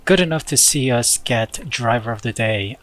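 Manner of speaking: ordinary talk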